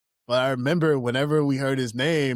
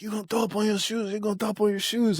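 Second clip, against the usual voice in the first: teasing taunt; 9 semitones higher, faster than usual. Retold or imitated. Friends teasing the drunk boy.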